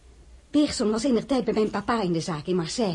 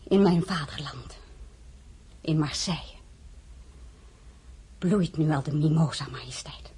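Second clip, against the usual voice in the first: weemoedig gefluisterd; 5 semitones lower than usual. Acted. Weemoedig over haar vaderland.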